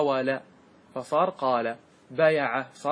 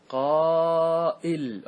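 "قائل" stretched very slowly and louder, qaa-'il; drawn out; 3 semitones higher, 5 dB louder, much slower than usual. Shown as a demonstration. Teacher stretching "قائل" for the class, very slow and louder. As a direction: draw out "قائل" and lean on the hamza. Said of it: "تقول".

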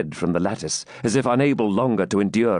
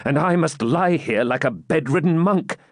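frustrated, higher-pitched exclamation; exclaiming knight; 7 semitones higher than usual. A narrator's reading. Wounded knight stuck in bed, exclaiming in frustration. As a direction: lament it as the bedridden knight. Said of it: "exclaimed Ivanhoe".

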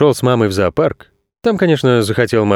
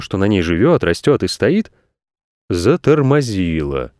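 usual speaking voice

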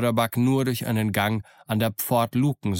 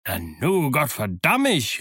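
surprised oath; 3 semitones higher, slower than usual. A narrator's reading. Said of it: "rief Long John".

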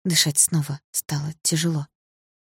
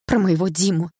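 usual speaking voice

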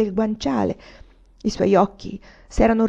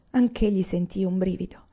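ordinary delivery, nothing marked

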